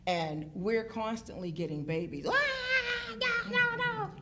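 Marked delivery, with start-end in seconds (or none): 2.3-4.1 put-on very high wailing baby voice; mimicking a screaming baby. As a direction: squeal it like a scared toddler. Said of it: "Screaming 'cause they don't wanna".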